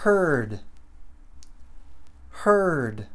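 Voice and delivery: "Heard" as one syllable, H-R-D; drawn-out R in "Heard", falling tone; English R pronunciation. Shown as a demonstration. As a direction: say "Heard" with only an R as its vowel. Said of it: "Essa pronúncia".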